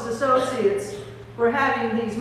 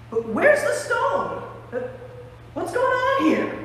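high, startled questioning; bewildered; 11 semitones higher than usual. Acted. Mary finding the tomb stone moved, bewildered. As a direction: act out baffled alarm at the find.

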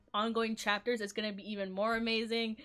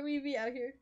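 ordinary talk

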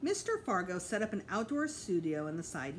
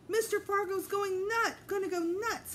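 alarmed; 13 semitones higher, 4 dB louder than usual. A narrator's reading. Peter warns Mom, alarmed.

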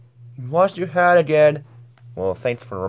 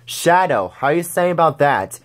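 ordinary delivery

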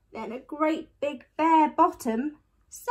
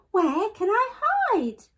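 put-on high, louder frightened child; panicked cry; 9 semitones higher, 5 dB louder than usual. A narrator's reading. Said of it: "cried Eddie".

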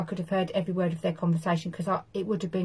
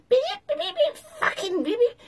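very high squeaking beeps; squeaky helium voice. Retold or imitated. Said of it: "she'd go".

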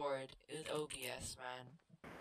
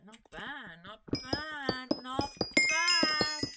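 building to a shout; 8 semitones higher, 7 dB louder, much slower than usual. Acted. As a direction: escalate each repeat to a yell. Repeats praise, getting louder.

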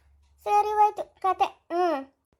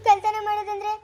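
usual speaking voice

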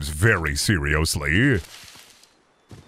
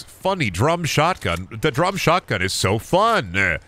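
mock ad read; 5 semitones higher than usual. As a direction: sell the drum shotgun like a cheesy commercial. Mock ad for drum shotgun.

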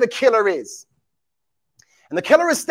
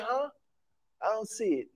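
mock obliviousness; 15 dB quieter than usual. Preacher mimics people blind to what is in their face, quiet mock confusion. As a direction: play the clueless onlooker, barely audible. Acting out people who cannot see.

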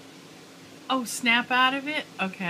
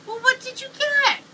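rising excitement; 11 semitones higher than usual. Real, not acted. Mom asking baby excitedly.

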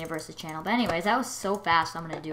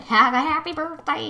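imitating; 10 semitones higher, 8 dB louder than usual. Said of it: "It's like".

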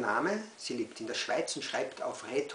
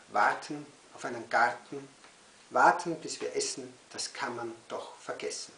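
measured recital with long pauses; each repeated word set apart, some punched, some dropping away; poem recital. Acted. Poet reads waiting poem to children, poem recital.

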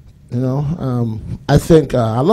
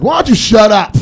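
mock bickering shout; 8 semitones higher, 6 dB louder than usual. Couple bickering, acted.

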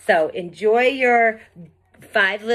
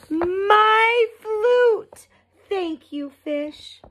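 overjoyed; 13 semitones higher, slower than usual. Acted. Fifi gets flute back.

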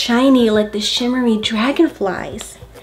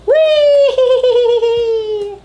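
high, louder playful whee; excited whee; 12 semitones higher, 4 dB louder than usual. Pretend. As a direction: swing along with delight. Monkeys swinging.

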